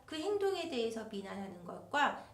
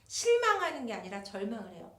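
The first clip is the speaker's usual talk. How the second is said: normal talking voice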